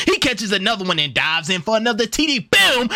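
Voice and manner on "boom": sudden loud high boom; hype shout. Real, not acted. Player celebrating his receiver's touchdown, loud hype shout. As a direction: bark it out as the touchdown lands.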